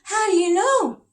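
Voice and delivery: high, loud startled cry; an anxious king's high cry; cried out. Acted. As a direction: blurt it out in shock. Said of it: "cried the king".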